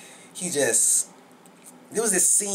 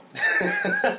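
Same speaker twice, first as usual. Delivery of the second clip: high laugh. Real, not acted. Laughing about Michael B. Jordan.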